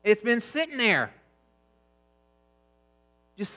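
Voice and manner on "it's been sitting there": ordinary talk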